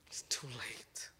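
slow, soft whisper; hushed ominous whisper; whispered warning. Real, not acted. Warning parents.

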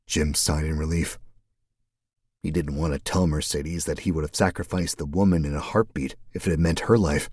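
plain reading voice

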